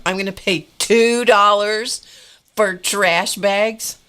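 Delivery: indignant cry at a ridiculous price; outraged disbelief. Retold or imitated.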